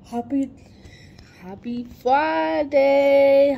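slow, loud stretched happy Friday; drawn-out greeting. Acted.

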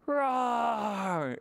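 mock roar for the kids; playful lion growl; hammed-up friendly roar; pretend lion roar. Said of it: "give a roar".

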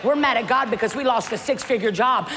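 usual speaking voice

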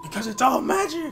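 excited shout. Real, not acted. Excited shout while gaming.

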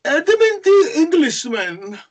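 high, loud, slow mocking of mother; over-the-top mother impression. Son mocking his mother about her English suitor, high, loud and slow. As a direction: ham up mother's swooning voice. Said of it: "impersonates her in an over-the-top fashion".

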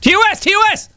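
high sharp yell; shouted call-out. Real, not acted. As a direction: call it out like a ref. Calling out terms of service.

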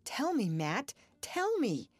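urgent plea leaping high on the repeat; imploring, building to a high cry; adjuring. A narrator's reading. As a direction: beg for an answer, pressing harder. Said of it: "he adjured her".

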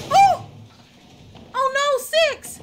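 loud high panicked cry; alarmed cry. Real, not acted. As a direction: yell out in sudden alarm. Companion grabbed in game.

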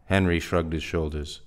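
plain reading voice

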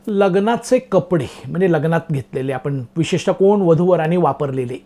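usual speaking voice

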